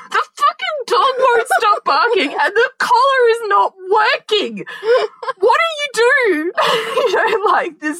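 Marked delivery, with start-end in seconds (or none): 0.1-6.5 high-pitched retold fury, slowing on the last question; exasperated phone rant. Wife ringing her husband about the barking dog, exasperated rant. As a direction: wail the complaint high, at wits' end.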